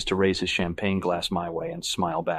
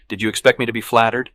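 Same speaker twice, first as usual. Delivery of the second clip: louder, spat-out retort; bitter; 3 semitones higher, 8 dB louder than usual. A narrator's reading. Woman retorting to her boyfriend, bitter. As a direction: spit the question out, mildly bitter. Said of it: "she spits out bitterly".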